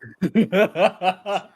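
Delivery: high, loud burst of laughing; real loud laughter. Real, not acted. Actor cracking up at his flubbed line, loud laughter. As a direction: crack up helplessly at a blunder.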